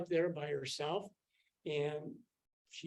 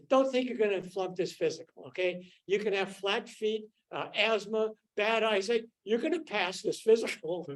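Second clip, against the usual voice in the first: loud, higher mock sergeant announcement; army sergeant; 5 semitones higher, 6 dB louder than usual. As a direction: lay down the law like a sergeant. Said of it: "the guy who was at the front".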